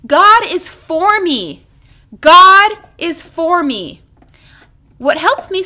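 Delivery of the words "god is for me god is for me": slow, louder declaration; declaring aloud with listeners. Shown as a demonstration. Preacher leading listeners in a spoken declaration. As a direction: proclaim it slowly for them to repeat. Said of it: "say these words with me".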